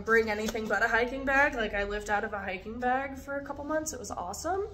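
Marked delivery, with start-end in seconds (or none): 3.9-4.7 mock-enthusiastic, higher and softer; sarcastically upbeat, in a softer voice; sarcastic. Real, not acted. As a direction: say it with obvious sarcasm. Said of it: "can you hear the sarcasm?".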